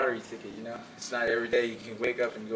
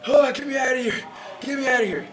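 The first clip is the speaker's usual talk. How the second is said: high, loud cry to be let out; desperate shout; 11 semitones higher, 6 dB louder than usual. Retold or imitated. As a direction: shout to escape the ring, half joking. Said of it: "I'm like".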